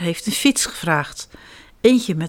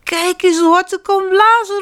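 much higher, louder proud call; 11 semitones higher, 4 dB louder than usual. Acted. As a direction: show off your blowing. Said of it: "roept hij". (olifantje roept trots en luid)

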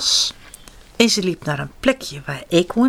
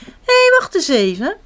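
high-pitched, louder burst of realization; excited storybook exclamation; calling out; 9 semitones higher, 5 dB louder than usual. A narrator's reading. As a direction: cry out to stop someone, voice leaping up. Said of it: "riep muis".

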